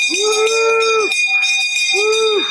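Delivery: high cheering woo; excited whoop. Real, not acted. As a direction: cheer loudly. Celebrating hitting the goal.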